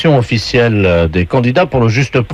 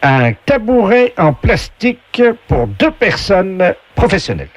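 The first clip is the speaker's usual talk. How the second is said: slow, higher game voice; game show announcer; 7 semitones higher than usual. Acted. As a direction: announce each prize with drawn-out flourish. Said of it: "la voix du jeu".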